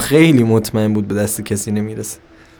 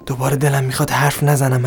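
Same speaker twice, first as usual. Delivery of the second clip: ordinary talk, nothing marked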